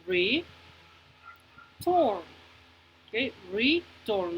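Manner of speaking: "return" split by a clear pause; teacher dividing "return" into two parts; syllable by syllable. Shown as a demonstration. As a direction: say re, wait, then turn in "return". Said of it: "dividir la palabra en dos partes".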